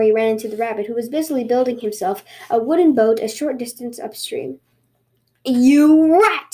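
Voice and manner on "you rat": loud, higher outraged snarl; angry snarl. Acted. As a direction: snarl it like a furious tanuki. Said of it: "snarled the tanuki".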